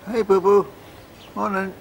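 high pet-talk hello; cooing greeting. Real, not acted. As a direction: greet the little animal in a high coo. Greeting an animal.

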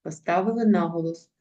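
ordinary delivery

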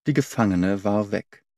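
usual speaking voice

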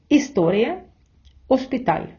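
історія and оспіталь begun straight on the vowel, no H; silent H. Shown as a demonstration. Teacher shows students Spanish words written with an H, read with silent H. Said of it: "читаємо без «аче»".

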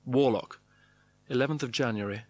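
usual speaking voice

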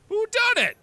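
very high, loud exclamation; mock-dramatic cry. Real, not acted. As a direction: call it out in a high, hammed-up voice. Breaks off reading to joke.

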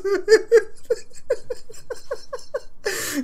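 a high wheezing laugh; tearful laugh. Real, not acted. Tearful laugh at the screen. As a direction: laugh till it breaks. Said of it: "I'm actually crying a little bit".

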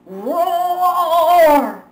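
loud, high sung T-Rex roar; dinosaur roar. Acted. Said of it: "cried Froggy".

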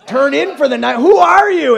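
high, loud disbelief, a mock wife's outrage; shocked wife. Wife mocking her husband, shocked and loud.

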